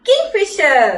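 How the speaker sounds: emphatic model word; clear teaching word. Shown as a demonstration. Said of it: "babies പറഞ്ഞേ".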